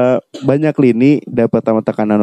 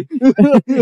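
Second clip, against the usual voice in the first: high laugh; 13 semitones higher than usual. Real, not acted. Tertawa keras. (tertawa lepas)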